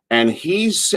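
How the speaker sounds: usual speaking voice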